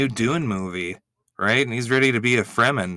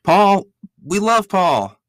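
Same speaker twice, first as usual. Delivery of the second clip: higher, louder, slow gushing cheer; thrilled gushing; 8 semitones higher, 7 dB louder, slower than usual. Real, not acted. Podcast host cheering a favorite guest appearing, excited loud gushing. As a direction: gush over the guest like a fan.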